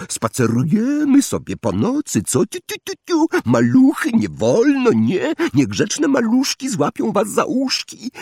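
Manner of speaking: very high, teasing singsong poltergeist; high tutting poltergeist mockery; mocking poltergeist. A narrator's reading. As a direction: taunt the little ones like a gleeful imp. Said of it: "Irytek zacmokał".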